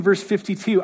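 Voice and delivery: ordinary talk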